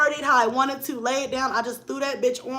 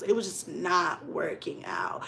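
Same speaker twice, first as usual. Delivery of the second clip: whispered aside; 5 semitones lower, 7 dB quieter, slower than usual. Real, not acted. Admitting the boots won't fit, in a whisper. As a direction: drop to a whisper, slower.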